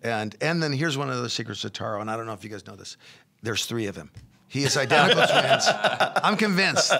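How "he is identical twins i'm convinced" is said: laughing through the joke, louder; giggling as the punchline lands. Real, not acted. Joking about Taro being triplets, laughing.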